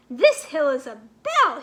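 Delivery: loud, delighted cry of discovery; excited exclaim. A narrator's reading. Milo finds he stands on the clock bell, delighted cry. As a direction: shout it out, thrilled. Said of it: "he exclaimed".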